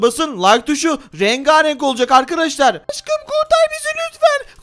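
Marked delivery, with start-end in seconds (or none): 2.9-4.6 put-on very high, slow, pleading girl voice; pleading spider girl. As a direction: beg your love to save you, squeaky and high. Spider girl begs for rescue. (çok ince sesle yalvaran örümcek kız)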